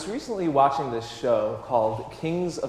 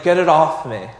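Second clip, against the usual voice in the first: mock yell, louder; retold scream; 7 dB louder than usual. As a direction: replay the bitten man's panicked cry. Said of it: "he's screaming".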